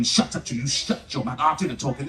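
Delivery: low, unvoiced hushed dad telling mum off; dad snapping. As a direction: hiss it low like a fed-up husband.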